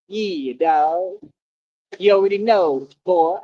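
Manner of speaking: ordinary talk, nothing marked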